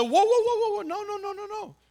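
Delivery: rising to a high exclamation, then fading; dad's high mock-alarmed whoa. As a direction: playfully stop her short, up high. Dad stopping scared daughter.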